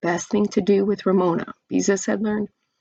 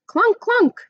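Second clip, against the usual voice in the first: much higher, louder clunking yell; yelled machine noise; 7 dB louder than usual. A narrator's reading. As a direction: yell it like a noisy pile driver. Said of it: "yelled Ramona".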